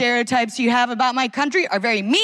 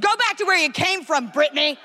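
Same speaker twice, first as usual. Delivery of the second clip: higher, mock-furious outburst; hammed-up indignant shout, much higher; imagined yell; 5 semitones higher than usual. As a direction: pretend to snap at the tourist across the carriage. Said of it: "I nearly stood up and yelled".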